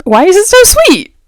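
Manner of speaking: loud second take of the complaint; exasperated outcry. Real, not acted. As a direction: throw the question up in despair. Repeats the complaint, now exasperated.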